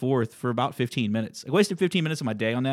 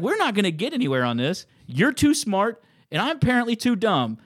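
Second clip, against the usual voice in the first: higher, louder heated sarcasm; sarcastic jab, voice raised high; worked up; 7 semitones higher, 4 dB louder than usual. Retold or imitated. Arguing with a rude caller, higher and louder sarcasm. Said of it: "I was so worked up".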